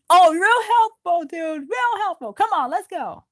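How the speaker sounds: high, loud sarcastic jab. Acted. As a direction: sneer at the useless remark. Sarcastic reply to odds.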